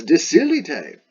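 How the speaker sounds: loud, high Latin command; standard-bearer's shout. Acted. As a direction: call it out to reluctant troops. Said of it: "I would say he shouted".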